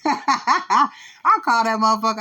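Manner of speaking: laughing through the words, pitch jumping high. Real, not acted. Telling a friend she called her baby daddy, laughing. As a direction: giggle the words out as you say them. Laughing while retelling the call.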